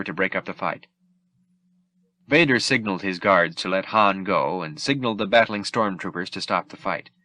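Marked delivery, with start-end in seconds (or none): none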